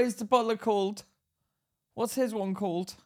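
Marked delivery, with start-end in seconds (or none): none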